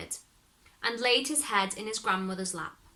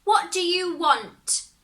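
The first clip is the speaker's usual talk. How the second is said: put-on high, slow, loud old woman's demand; a crone demanding his business; devil's grandmother confronts the intruder, high and slow; grandmother's challenge; 9 semitones higher, 7 dB louder, slower than usual.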